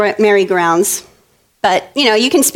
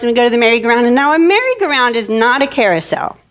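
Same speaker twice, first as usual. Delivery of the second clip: usual speaking voice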